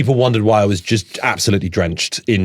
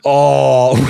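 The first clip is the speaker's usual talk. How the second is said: ordinary delivery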